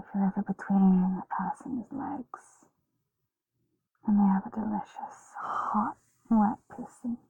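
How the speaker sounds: whispering. Real, not acted. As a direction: whisper it close and intimate. Intimate ASMR whisper.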